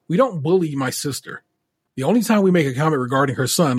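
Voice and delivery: usual speaking voice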